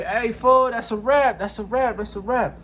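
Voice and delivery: high repeated call to stop; high homie voice. Retold or imitated. Homie Dreamer calling out.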